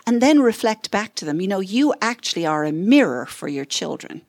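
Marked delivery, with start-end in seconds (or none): none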